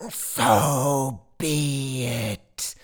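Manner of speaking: very slow, high monstrous cry; drawn-out howl of wicked glee; gleeful creature cry. A narrator's reading. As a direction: cry it out high and long, gloating. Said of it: "it cries, gleeful".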